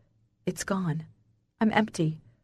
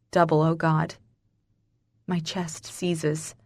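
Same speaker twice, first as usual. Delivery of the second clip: plain reading voice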